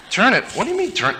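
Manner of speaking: high puzzled questioning; confused kid. Retold or imitated. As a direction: sound baffled by the idea. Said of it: "And he said".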